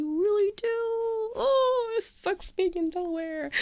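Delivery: high-pitched whine of longing; mock whiny lament. Real, not acted. Fan wishing she lived near the New York gang, high mock whine. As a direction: wail it high, half-joking.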